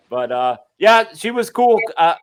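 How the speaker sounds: ordinary delivery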